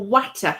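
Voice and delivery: "water" with an emphasised flat A, as in "cat"; teasing copy of a twangy "water"; imitated Midlands twang. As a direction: mimic the twang, saying "water" as "watta". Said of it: "You either emphasise your A's or you just go".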